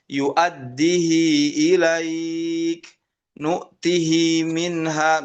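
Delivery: slow, drawn-out Quranic recitation, Yu'addihi with a long kasrah "hi"; recited with kasrah on hi. Shown as a demonstration. Teacher recites examples to Quran students, slow and drawn out. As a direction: chant each word slowly, ending in a clear "hi". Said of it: "Misal, misalkan".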